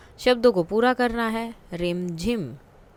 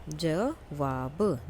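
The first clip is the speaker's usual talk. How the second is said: slow dictation; 4 semitones lower, 6 dB quieter, much slower than usual. Shown as a demonstration.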